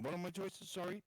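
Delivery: usual speaking voice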